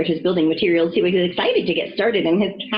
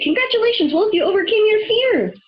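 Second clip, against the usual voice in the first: high, proud cheer; crowing. Acted. Alfred praising Wolf for overcoming fear, crowing. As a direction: crow it out, delighted for him. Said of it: "crowed Alfred".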